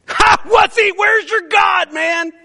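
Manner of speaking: high, loud taunting jeer; mocking shout. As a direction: jeer at their absent god. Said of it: "Elijah mocked them".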